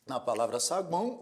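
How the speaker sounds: ordinary talk, nothing marked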